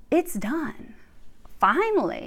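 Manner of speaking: normal talking voice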